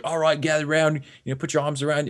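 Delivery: a mild Aussie twang for a gym leader rallying the group; imitating the trainer in an Australian accent. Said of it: "probably more of an Australian accent".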